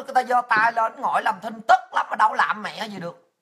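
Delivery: ordinary delivery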